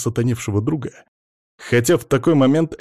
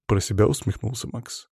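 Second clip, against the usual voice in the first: ordinary delivery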